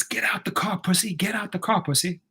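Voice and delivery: unvoiced mocking taunt from the car; taunting whisper. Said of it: "Guy gets".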